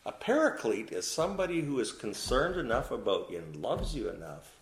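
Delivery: ordinary delivery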